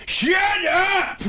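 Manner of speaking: sudden high-pitched yelled outburst; Adam Sandler yell. Retold or imitated. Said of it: "he yells and he's just like".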